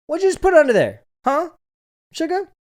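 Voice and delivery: imitating a guard, high and loud; high-pitched, loud mock challenge from a guard; security guard impression. Security guard confronting a guest leaving a note, high loud challenge. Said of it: "It's a security guard".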